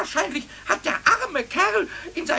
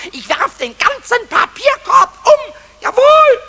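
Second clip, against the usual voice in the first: loud defiant cry; furious shout; 5 semitones higher, 9 dB louder than usual. Acted. Goblin tipping over the bin, furious tearful yell. Pumuckl raging at teacher.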